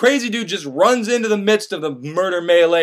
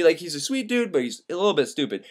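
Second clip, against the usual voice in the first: usual speaking voice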